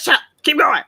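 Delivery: loud, high retort of a fed-up mom; play-acted exasperated order; mock scolding. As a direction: imitate a mother shutting down whining kids. Acting herself scolding her kids.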